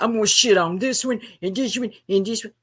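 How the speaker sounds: sing-song mock threat; mocking impersonation. Quoting Matthew's roast talk back at him, mocking. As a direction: parody his repetitive trash talk in a put-on voice. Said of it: "to be talking about".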